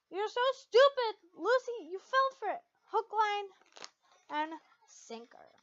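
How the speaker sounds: high, sneering, sing-song taunt; mocking taunt. Inner voice mocking Lucy for falling for it, high taunt. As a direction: jeer at her like a bully.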